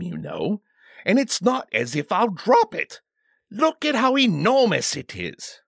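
high-pitched, flustered royal protest; indignant demanding king. A narrator's reading. King objecting to his bodyguard's warning, indignant and high. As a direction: protest being treated like a child, pitch jumping high. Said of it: "the king demanded, blushing from surprise".

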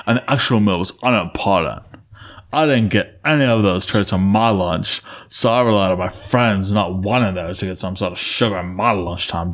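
hammed-up impression of a listener writing in; Rex. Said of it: "that was my Rex voice".

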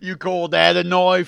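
hammed-up Aussie film-hero impression; bad Australian accent. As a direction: belt it out in a dodgy Australian accent. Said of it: "was that Australian?".

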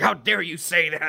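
high, put-on indignant voice; mock outrage. Offended dude answering an insult to the army, high mock outrage.

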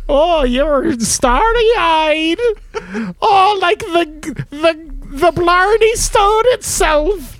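slow, high, hammed-up Irish lilt; mock Irish brogue. Host mocks the Irish hologram's lines in a put-on brogue. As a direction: lightly put on a stage-Irish voice. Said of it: "He got this accent".

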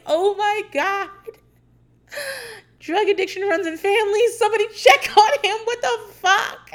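high, loud alarmed rant ending in laughter; mock alarm. Worried her brother is on drugs, mock alarmed. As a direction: panic about him, half joking. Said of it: "I would be like".

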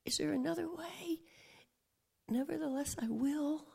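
barely-voiced prayer to the Father; whispered prayer. Jesus in Gethsemane praying to the Father, whispered. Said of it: "He's like".

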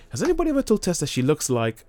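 high-pitched imitation of an eager asker; mock curious question. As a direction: pipe up with a naive question. Acting out characters' curious question.

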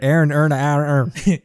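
put-on Baltimore accent. Closing the joke, in a mock Baltimore accent. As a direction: repeat "Aaron earn" drawled. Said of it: "Aaron Earn the Iron Earn".

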